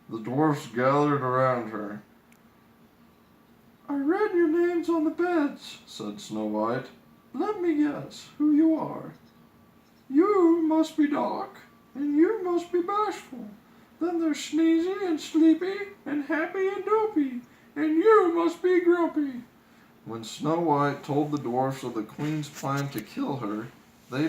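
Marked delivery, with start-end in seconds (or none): 3.9-5.8 high princess voice; light high young princess. Acted. 7.3-19.4 high, playful princess voice. Acted. Princess names dwarfs.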